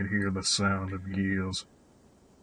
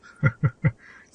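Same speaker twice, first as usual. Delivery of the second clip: put-on amused old chuckle; 5 semitones higher, 6 dB louder than usual. Old man amused by question.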